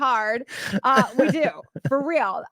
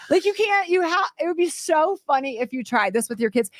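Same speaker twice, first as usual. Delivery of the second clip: ordinary delivery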